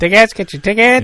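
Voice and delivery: pushy ticket tout. Pretend. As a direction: call out like a scalper. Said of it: "Scalpers at the front door".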